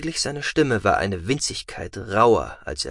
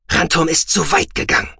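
sharp furious hiss, higher; angry hiss; 8 semitones higher, 5 dB louder than usual. Acted. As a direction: hiss it through anger. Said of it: "zischte er".

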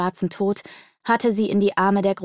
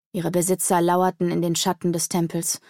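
ordinary delivery

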